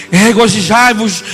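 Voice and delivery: slow, loud scripture reading; scripture declaimed. Real, not acted. Preacher proclaiming Revelation to the church, slow and loud. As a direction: proclaim the verse slowly.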